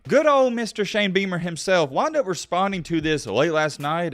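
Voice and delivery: ordinary talk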